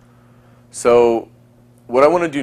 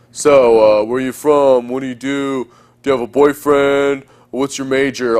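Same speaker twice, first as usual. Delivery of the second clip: boring interview questions. Shown as a demonstration. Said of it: "All that kind of crap".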